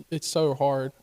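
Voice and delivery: ordinary talk, nothing marked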